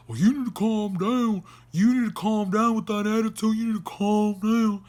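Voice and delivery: sing-song mocking imitation; mocking the friends. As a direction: mimic someone preaching calm. Mocking friends telling her to calm down.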